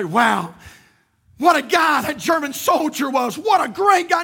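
loud high sarcastic praise; mock admiration. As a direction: shout it as a mocking cheer. Mocking imagined reaction.

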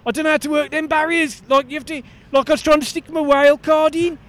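chirpy Aussie bloke; aussie tradesman. Acted. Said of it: "it's Brian".

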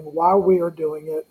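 ordinary talk

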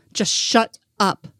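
slow, word-by-word demand; restrained scream. A narrator's reading. Crying mother silencing Joe, slow restrained scream. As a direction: space out each word for emphasis, without shouting. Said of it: "I screamed at him".

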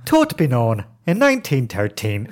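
put-on Dublin Irish; flat Irish accent. Shown as a demonstration. Demonstrating Irish after Scottish, flat Irish accent. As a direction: do the Irish lilt kept flat. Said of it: "Irish is flat".

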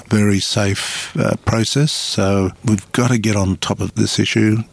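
ordinary talk, nothing marked

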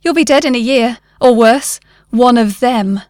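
loud parting shot, punched phrases; shouted warning. A narrator's reading. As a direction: call a dire warning at his back. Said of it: "the man shouted at his back".

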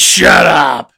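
Vince's loud retort, hammed up; big imitated shout, drawn out; mock yelled order. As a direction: yell it like an irritated boss in a skit. Vince voiced yelling from another room.

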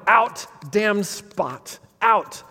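a sleepwalker's slow, haunted cry; Lady Macbeth crying out. Acted. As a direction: drag each word out in torment. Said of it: "she's crying out".

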